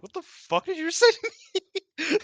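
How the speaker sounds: high mock outrage, laughing through it; baffled Mayu. Imitating Mayu confused by the yelling, high laughing voice. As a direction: squeak it out in disbelief, cracking up. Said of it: "Mayu's like".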